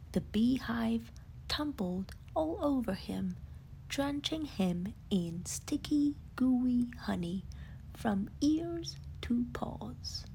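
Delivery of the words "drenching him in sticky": plain reading voice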